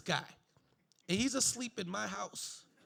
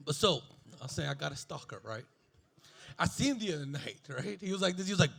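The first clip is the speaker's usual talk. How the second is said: ordinary talk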